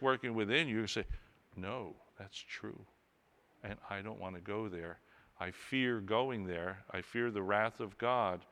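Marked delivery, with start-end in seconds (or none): 1.5-8.4 hushed fearful confession. Retold or imitated. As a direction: murmur it like a trembling confession. Convicted listener's imagined reply.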